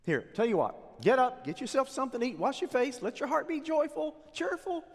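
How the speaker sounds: the queen coaxes the king to eat; coaxing Jezebel. Acted. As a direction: cheer up a sulking husband.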